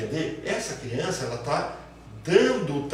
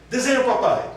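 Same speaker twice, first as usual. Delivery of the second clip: higher and louder adult request; bright request; 10 semitones higher, 9 dB louder than usual. Retold or imitated. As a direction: call it out to the child. (pedindo à criança, mais alto e agudo)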